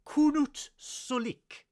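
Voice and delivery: high, slow, grand proclamation; hammed-up formal announcement; mock Klingon declaration. Klingon term declared dramatically.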